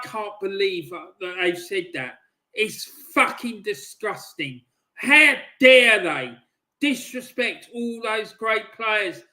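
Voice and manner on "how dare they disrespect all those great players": louder, slow, indignant shout; outraged. Real, not acted. Fan slamming the fans who disrespect Graham, slow outraged shout. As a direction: let the outrage burst out slowly.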